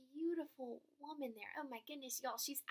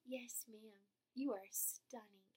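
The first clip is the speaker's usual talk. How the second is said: tender; 4 semitones lower, 7 dB quieter, slower than usual. Retold or imitated. Reassures woman softly.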